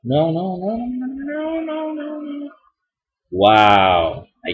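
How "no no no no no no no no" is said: real dismay, drawn out; despairing drawn-out no. Real, not acted. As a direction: moan no on one long high note as it all goes wrong. Watching a run fail.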